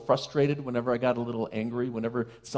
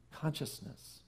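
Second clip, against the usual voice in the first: whispered single word; 13 dB quieter, slower than usual. Real, not acted. Minister whispering the key word to the congregation, whispered. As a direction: breathe the word out unvoiced.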